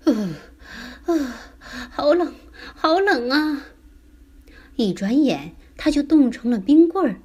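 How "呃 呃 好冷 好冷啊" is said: put-on high child voice shivering with cold. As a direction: act chilled through, with little shudders. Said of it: "他打着哆嗦".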